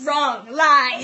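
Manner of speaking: loud, punchy denial; loud protest. Real, not acted. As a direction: shout it down. Bursting out the truth.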